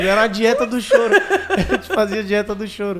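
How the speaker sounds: real laughing. Real, not acted. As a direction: giggle through it. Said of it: "laughing at own joke".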